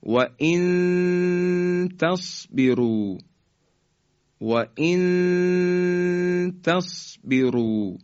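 و ان تصبروا with the نون of ان hidden in a long nasal hum, slow; slow Quranic recitation of و ان تصبروا; ikhfa recitation. Shown as a demonstration. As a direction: recite و ان تصبروا holding the ghunna.

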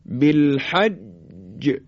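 بالحج very slow, ج held then bounced; qalqalah on doubled jim. Shown as a demonstration. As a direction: hold the ج of بالحج and pop it open. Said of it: "حالا در حرف جیم توجه بفرمایید".

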